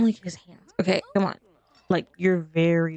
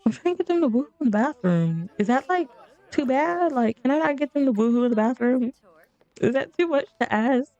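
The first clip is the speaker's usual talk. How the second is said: higher pitched, whiny plea to the game; exasperated pleading; 7 semitones higher than usual. Real, not acted. Gamer pleading with her Sims, high exasperated whine. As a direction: beg the Sims to just cooperate, half laughing.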